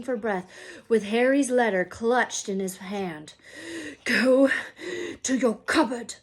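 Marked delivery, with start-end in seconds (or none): 4.0-6.1 wheezed. A narrator's reading. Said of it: "he wheezed at Harry".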